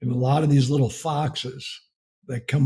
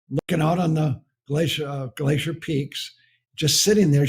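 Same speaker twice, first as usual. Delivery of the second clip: ordinary delivery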